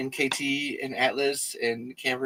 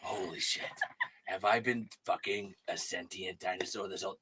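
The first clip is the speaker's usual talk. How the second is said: ordinary talk, nothing marked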